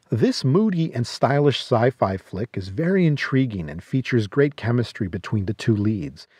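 ordinary talk, nothing marked

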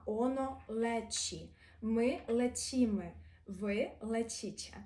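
«оно лечі», «ми лечіми», «ви лечіче» slowly with a soft ч; teacher shows the soft ч; soft Polish sounds. Shown as a demonstration. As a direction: drawl the forms, each ч soft. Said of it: "так само м'яко ми кажемо".

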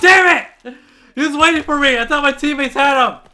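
high, loud frustrated shouting; furious yell. Real, not acted. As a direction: rage at the screen. Killed again.

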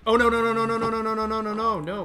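loud, high panicked protest; rapid alarmed string of no. Real, not acted. Helmet going wrong, panicked.

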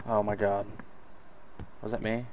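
ordinary delivery

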